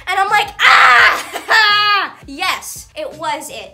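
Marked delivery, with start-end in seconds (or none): 0.6-2.1 loud high tearful scream; crying scream. Retold or imitated. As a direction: let out a sobbing shriek. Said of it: "I'm crying right now".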